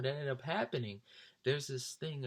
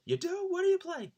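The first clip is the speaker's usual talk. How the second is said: much higher, louder mock voice; Brian excited; 7 dB louder than usual. Brian gets curious about the guitar, high loud mock voice. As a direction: pop it up high and eager.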